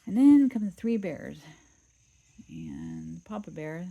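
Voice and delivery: ordinary delivery, nothing marked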